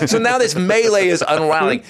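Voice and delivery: ordinary talk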